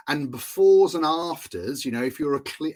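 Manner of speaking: ordinary delivery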